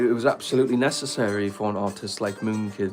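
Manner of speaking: like a pundit looking back decades later; documentary talking head. Acted. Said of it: "here's the documentary right here".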